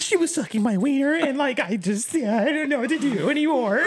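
high, laughing mock whine; mock him in a high funny whine. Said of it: "I was saying it in a funny manner".

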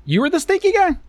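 surprised. Real, not acted. Co-host admits being stinky.

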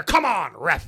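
high, loud whiny complaint; complaining Vince McMahon. Retold or imitated. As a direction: whine it at the ref. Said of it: "Vince complains".

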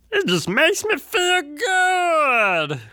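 high, slow, drawn-out cartoon voice; Cleveland voice. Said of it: "Does that still sound like Cleveland?".

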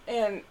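real cough, wine went down wrong. Real, not acted. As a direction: cough before speaking. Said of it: "I'm sorry about the coughing".

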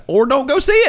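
high-pitched emphatic burst; sudden squawked dismissal; exclaimed retort. Real, not acted. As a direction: throw it out high and exasperated. Exasperated punchline to upset fans.

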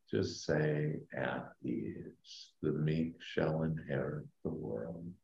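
slow, soft meditation voice; sleep story narrator. Acted. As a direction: guide them down gently, fading to a whisper. Leads a guided meditation.

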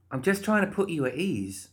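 high-pitched character voice, soothing; pushy, reassuring Molly. Acted. Molly trying to calm the guard, reassuring.

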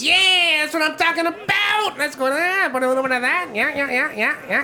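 high, chirpy innkeeper gnome; Gilly the gnome. Acted. Gnome innkeeper offering ales, high and chirpy.

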